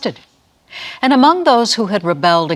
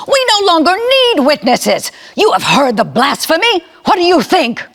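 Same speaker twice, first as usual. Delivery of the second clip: much higher, louder outraged voice; outraged cry; 11 semitones higher, 5 dB louder than usual. Acted. High priest tearing his clothes, outraged shout. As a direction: cry out in righteous fury.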